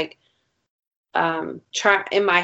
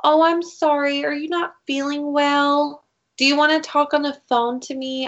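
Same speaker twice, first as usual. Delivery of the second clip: mocking clingy guy; 7 semitones higher than usual. Mocking his reply to her angry text, higher mock-sweet. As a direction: ham up his fake sympathy. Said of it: "you know what he fucking texted me?".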